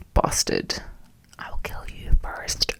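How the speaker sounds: hammed-up murderous whisper; whispered threat. Joking revenge on a rude boyfriend, whispered. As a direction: hiss a joking death threat.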